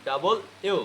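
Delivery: ডাবল ইউ as two separate words; unmerged "da-bol yu" for ডাবল ইউ; full pronunciation. Shown as a demonstration. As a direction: sound out ডাবল, then a distinct ইউ. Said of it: "কি বলতে হবে".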